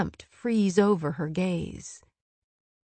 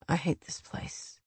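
muttered; 8 dB quieter than usual. A narrator's reading. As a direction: mutter it under the breath. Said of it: "he muttered".

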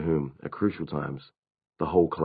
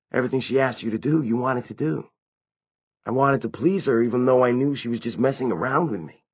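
higher-pitched character voice, confessing; lightly acted vampire, pitched up; vampire explaining himself; 6 semitones higher than usual. A narrator's reading. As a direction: explain her pull in a higher voice.

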